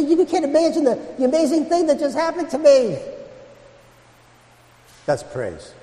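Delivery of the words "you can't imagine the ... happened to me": high-pitched breathless wonder; excited amazement. Retold or imitated. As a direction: gush about the amazing news. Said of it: "You're gonna say".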